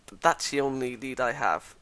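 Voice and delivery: usual speaking voice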